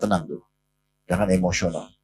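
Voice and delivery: calm a furious friend gently; calm and soft. Retold or imitated. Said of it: "Nabi dengan tenang memberikan isyarat".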